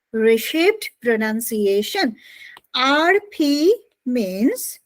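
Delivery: slow, spaced-out dictation; dictating while writing. Real, not acted. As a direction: say it slowly as you write it out.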